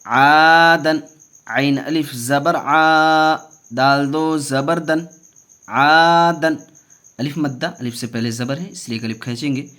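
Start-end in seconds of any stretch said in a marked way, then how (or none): 0.1-6.6 loud, slow chant with drawn-out long vowels; stretched teaching chant; Qaida spelling recitation. Shown as a demonstration. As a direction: project each syllable, holding the long ones. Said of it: "कैसे पढ़ेंगे".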